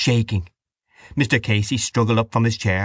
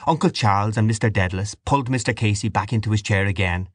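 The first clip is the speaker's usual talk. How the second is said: plain reading voice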